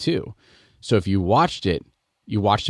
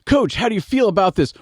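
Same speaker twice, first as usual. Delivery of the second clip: mock reporter; 12 semitones higher than usual. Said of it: "they're like".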